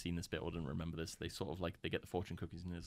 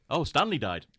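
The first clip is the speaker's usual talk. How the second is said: mock dumb realisation; 8 semitones higher, 15 dB louder than usual. Mock quote of characters.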